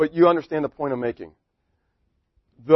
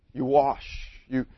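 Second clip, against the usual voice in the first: ordinary talk, nothing marked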